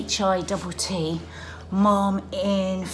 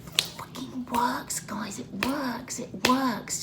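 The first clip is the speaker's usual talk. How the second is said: whispering; 5 semitones higher, 6 dB quieter, much slower than usual. Real, not acted. Excited secret about workout.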